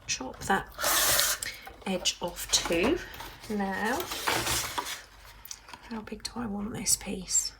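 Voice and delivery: whispered question to herself; breathy unvoiced musing. Real, not acted. Whispered while working.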